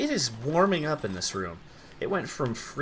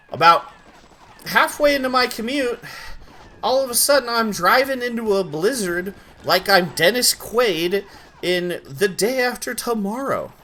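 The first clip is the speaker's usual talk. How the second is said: excited storytelling; 8 semitones higher, 5 dB louder than usual. Real, not acted.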